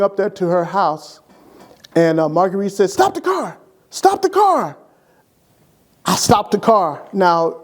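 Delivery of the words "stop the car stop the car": urgent cry. Retold or imitated. Wife shouting to stop car.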